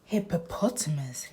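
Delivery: ordinary delivery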